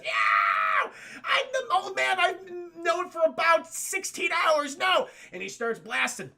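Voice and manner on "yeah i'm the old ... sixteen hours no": mock crying. Luke crying over Obi-Wan.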